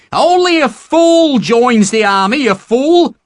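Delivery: high, loud, slow furious tirade; an angry mother berating the recruits; screaming in rage. Acted. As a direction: scold them like an outraged mother. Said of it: "screaming in rage".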